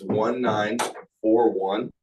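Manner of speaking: very slow, each digit spaced out; slow dictating. Shown as a demonstration. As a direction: spell out the digits slowly for writing. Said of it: "can you say that one more time?".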